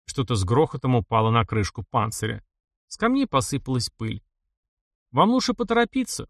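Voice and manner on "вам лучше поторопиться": indifferent. A narrator's reading. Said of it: "таким безразличным тоном".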